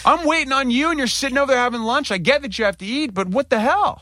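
mock outraged rant at the nurse; retold outburst. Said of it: "And I went".

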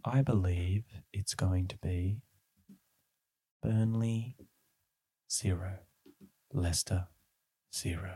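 slow breathy creepy whisper; creepy prediction voice. Acted. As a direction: whisper it slowly like an oracle. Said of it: "I better use my, uh, prediction voice".

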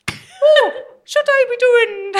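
high, loud, hammed-up Irish brogue; drawn-out, squealing mock-Irish; bad Irish accent. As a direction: put on a wildly overdone Irish voice. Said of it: "If that's your Irish".

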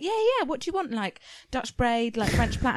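high, keen stylist reeling off options; eager hairdresser. Retold or imitated. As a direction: bubbly offer of styles. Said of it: "And she was like".

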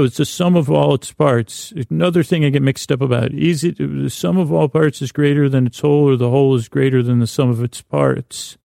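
ordinary talk, nothing marked

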